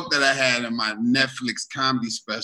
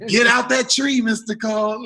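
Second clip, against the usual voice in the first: mock calling out; 9 semitones higher than usual. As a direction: holler at Mister Cole to come down. Calling to old man in tree.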